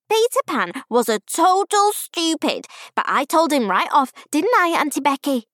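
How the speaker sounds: put-on high, gushing child voice; eager, high-pitched child; excited little girl. A narrator's reading. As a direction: tell Daddy all about it like a thrilled five-year-old. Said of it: "voice was still hoarse from shouting".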